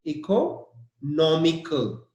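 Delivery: Economical slowly with no stressed. Shown as a demonstration. Teacher reminds students of the stress, slowly. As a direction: draw out Economical, hitting no. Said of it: "the stress is on N O".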